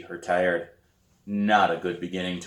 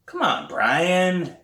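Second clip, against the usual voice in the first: pleading; 10 semitones higher, 5 dB louder, much slower than usual. A narrator's reading. Pleading with brother.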